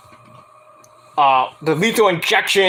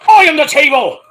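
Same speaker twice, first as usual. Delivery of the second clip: loud, high hammed-up shout; big booming quote, pitched up; mock bellow; 10 semitones higher, 7 dB louder than usual. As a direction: holler it like a wrestling catchphrase. Acts out quote from match.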